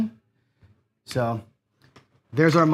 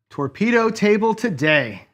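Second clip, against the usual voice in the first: slow, higher, louder proclamation; dramatic announcement; 6 semitones higher, 4 dB louder, slower than usual. Real, not acted. Streamer to chat, naming the cursed table, slow and loud. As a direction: drag it out like a headline.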